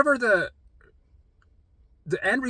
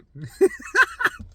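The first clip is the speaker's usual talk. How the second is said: high laugh. Real, not acted. Laughs after mock confrontation.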